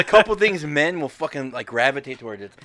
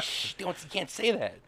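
mock hushing; 5 semitones higher, 8 dB quieter than usual. Hushing his daughter.

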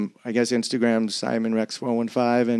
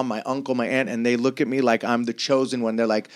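ordinary talk

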